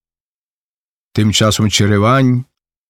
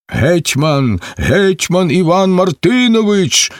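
crowd shouting; 6 semitones higher than usual. A narrator's reading. Crowd hails the new hetman at full voice. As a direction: cheer the name of the hetman. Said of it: "кричать на все горло".

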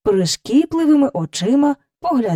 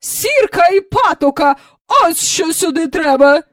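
high, loud pronouncement; imperious prescribing; 9 semitones higher, 5 dB louder than usual. A narrator's reading. Visitor prescribing a cure to the nanny, imperious. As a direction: decree it like a stern matron.

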